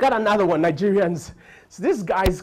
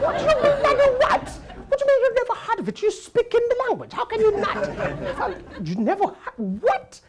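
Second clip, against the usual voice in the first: very high, louder outraged English lady; indignant Englishwoman; 3 dB louder than usual. Retold or imitated. English shopper outraged he never heard of England, very high indignant voice. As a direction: squeal in disbelief.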